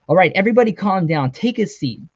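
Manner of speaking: modulated announcer voice. A narrator's reading. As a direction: command the room like a game master. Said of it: "the modulated voice buzzed".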